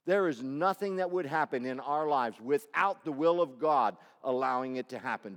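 ordinary talk

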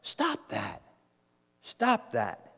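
low, quiet reprimand, voicing Jesus; restrained rebuke. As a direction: rebuke them softly, without shouting. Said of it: "He rebukes the disciples".

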